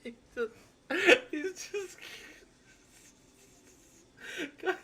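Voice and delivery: cracking up so hard the words barely come out; tearful high-pitched giggling, real; helpless laughing fit. Real, not acted. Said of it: "Guys, I'm fucking crying".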